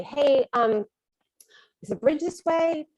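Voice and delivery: high, hopeful asking for directions; polite, uncertain plea to a stranger; tentative question. Retold or imitated. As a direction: lift it into a meek, hesitant question. Tentatively asks truck driver for directions.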